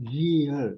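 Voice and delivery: year said loud and clear as the English year; correct pronunciation. Shown as a demonstration. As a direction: sound out year firmly. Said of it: "but it is actually". (ज़ोर से साफ़ year)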